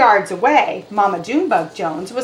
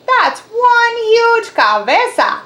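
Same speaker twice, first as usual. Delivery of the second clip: high, slow amazed voice; 13 semitones higher, 4 dB louder, slower than usual. Acted. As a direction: marvel at the size. Cat amazed at big head.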